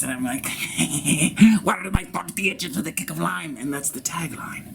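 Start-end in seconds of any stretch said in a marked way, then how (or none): 0.5-1.6 rising, loud put-on giggle; mocking cackle. Taunting professors to their face, mocking giggle. 1.6-3.6 higher-pitched bratty catchphrase; snotty-nosed brat. Acted. Tagline said to professors' faces, bratty taunt. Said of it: "look at them in the face".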